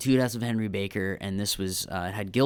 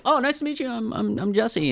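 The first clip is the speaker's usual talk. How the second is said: much higher, eager introduction; high, overeager introduction; nervous fan voice; 13 semitones higher than usual. Retold or imitated. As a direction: squeak out your name like a starstruck fan.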